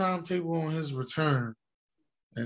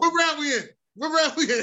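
playful high call; 11 semitones higher, 3 dB louder than usual. Real, not acted. Mock boxing-announcer banter.

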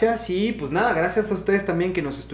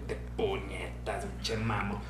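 slow, soft mock grumble under the breath; muttered insult; 7 semitones lower, 11 dB quieter, much slower than usual. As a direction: mutter the insult slowly to himself. Quoting his insult under his breath. (insulto murmurado lento y en voz baja)

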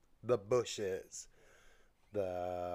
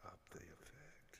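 whisper; 2 semitones higher, 23 dB quieter than usual. Real, not acted. Asks for effect off mid-recording.

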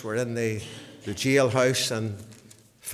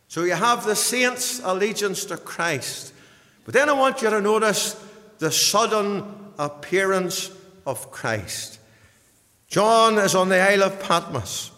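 raised, emphatic pulpit voice; impassioned preaching; 7 semitones higher, 6 dB louder than usual. Real, not acted. Preacher announcing Christ's sudden appearance, impassioned preaching. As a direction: proclaim it to the congregation, high and weighty.

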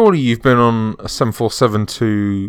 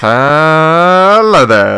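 slow, stretched-out mock greeting; drawn-out hello; 4 semitones higher, 6 dB louder, much slower than usual. Greeting the host back in a slow put-on voice. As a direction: draw the hello out long and loud.

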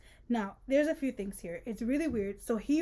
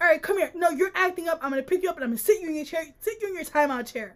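raised, rushed mock parental telling-off; mock scolding parent; 4 semitones higher, much faster than usual. Parent sending a child to the timeout chair, mock scolding.

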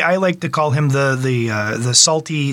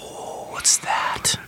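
scared little whisper; 8 dB quieter, much slower than usual. Pretend. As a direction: breathe it out, spooked. Reacting to spooky shark music.

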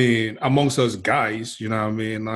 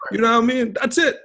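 laughing; 12 semitones higher, faster than usual. Real, not acted.

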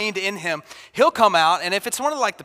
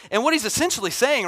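ordinary talk, nothing marked

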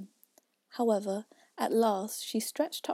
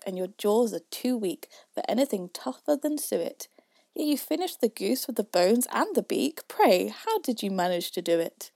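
louder, rhythmic verse; poem recitation; 6 dB louder than usual. A narrator's reading. Youth questions Father William, in loud recitation. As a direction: recite it out with a firm beat.

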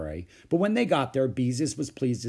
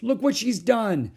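high, loud, dismayed tattling; upset child's loud complaint; crying out; 11 semitones higher, 5 dB louder than usual. A narrator's reading. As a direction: report the outrage to Mother. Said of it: "cried Beezus".

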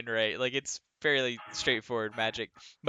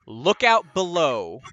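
slow call; 3 semitones higher, 6 dB louder, slower than usual. Acted. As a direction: call it out slowly, a bit loud. Captain jumping over banister.

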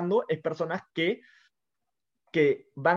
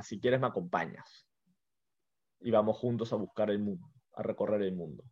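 much softer, low, fading invitation; soft wolf; 8 semitones lower, 9 dB quieter than usual. Acted. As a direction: let the wolf's offer sink to a hush. Wolf's closing invitation, told softly.

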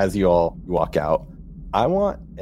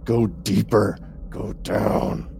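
eerie possessed whisper; otherworldly possessed voice; 4 semitones higher, much slower than usual. Acted.

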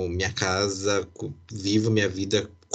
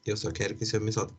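hushed, much softer private plea; inner thought; 8 dB quieter than usual. Retold or imitated. Son thinking during his father's long hug, hushed inner plea. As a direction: say it under the voice, as if only thinking. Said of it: "eu só pensava assim na minha cabeça".

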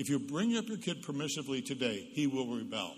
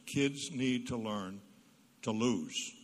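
slow emphasis; 2 semitones lower, 4 dB louder, much slower than usual. Real, not acted. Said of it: "slow emphatic point".

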